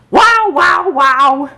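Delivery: high, loud howling wows; howling chorus. A narrator's reading. Cook and baby joining the chorus, loud howls. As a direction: yowl the chorus. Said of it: "And now the chorus".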